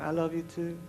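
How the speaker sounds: very soft loving reply; hushed wife. Retold or imitated. Wife answering tired husband at night, hushed. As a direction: barely voice her answer. Voicing wife's bedtime reply.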